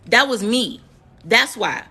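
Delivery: usual speaking voice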